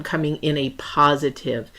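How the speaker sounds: ordinary talk, nothing marked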